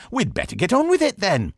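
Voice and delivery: ready to duel; Inigo. A narrator's reading. Inigo speaks.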